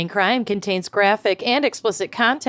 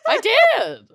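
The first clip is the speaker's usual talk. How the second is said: laughing; 4 dB louder than usual. Real, not acted. Caught out, laughing.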